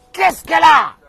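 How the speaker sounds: a hammed-up angry husband at his wife; irritated husband yell. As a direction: bark it out, exasperated at the wife.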